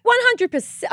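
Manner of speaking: very high, loud insistence; emphatic. Real, not acted. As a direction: insist with a squeal. Emphatic agreement.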